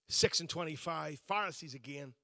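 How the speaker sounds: usual speaking voice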